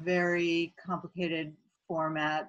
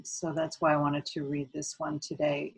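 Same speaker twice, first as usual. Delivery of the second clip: usual speaking voice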